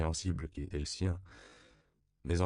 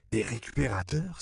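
"Des récupérateurs?" exclaimed with a rising, higher question; surprised cry; 5 semitones higher than usual. A narrator's reading. Missionary echoing the word in surprise, rising "Des récupérateurs?". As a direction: echo "Des récupérateurs?" in astonished disbelief. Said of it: "s'écria Edward Bancho".

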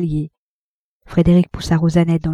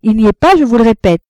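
higher, louder, emphatic denial; insistent rebuff; 4 semitones higher, 7 dB louder than usual. A narrator's reading. Wife repeating to her rival he is out, insistent. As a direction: insist on it, raised and firm.

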